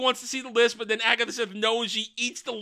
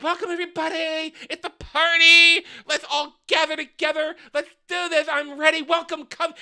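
higher, gushing party-host voice; Twink host voice; 8 semitones higher, 3 dB louder than usual. Retold or imitated. Twink greeting the coven at his party, high and gushing. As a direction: welcome the coven like an eager host. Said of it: "he's like".

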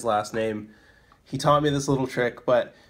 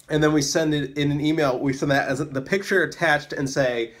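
normal talking voice

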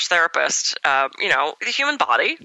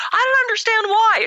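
clueless client. Retold or imitated. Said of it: "She was just clueless".

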